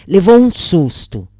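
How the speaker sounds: ordinary delivery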